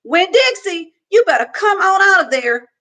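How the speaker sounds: put-on girl hollering for her dog at the gate. A narrator's reading. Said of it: "went up to the gate and hollered".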